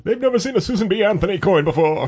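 put-on high, loud old newsreel announcer; 1940s announcer voice. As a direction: boom it like a wartime radio announcer. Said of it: "That was my nineteen forties announcer".

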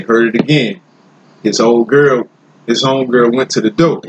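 ordinary talk, nothing marked

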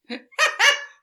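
high giggling burst; high laugh. Real, not acted. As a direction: laugh at your own slip. Laughs at own slip.

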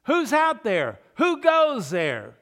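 loud high cry at the gates; calling out. Acted. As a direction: shout the challenge from the tower. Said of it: "when they hear the cry".

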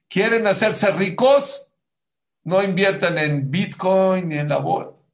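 loud, high, slow preacher's call; emphatic exhortation. Acted. Preacher tells husbands how to get rich, loudly emphatic. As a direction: stretch it out and proclaim it.

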